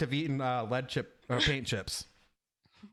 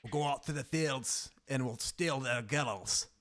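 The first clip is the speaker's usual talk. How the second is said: put-on Scottish accent; 4 dB louder than usual. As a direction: play a Highlander raider in broad Scots. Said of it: "Could you do a Scottish?".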